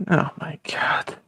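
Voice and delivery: slow whispered "oh my God"; exasperated whisper. Real, not acted. Aside from a fellow nation about America, slow exasperated whisper. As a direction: breathe it out under your breath, fed up.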